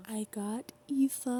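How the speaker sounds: ordinary talk, nothing marked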